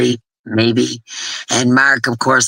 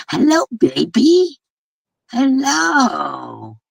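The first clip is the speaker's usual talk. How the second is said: much higher, slow cooing hello; high slow greeting to a pet; 9 semitones higher, much slower than usual. Real, not acted. Greeting a kitten on camera.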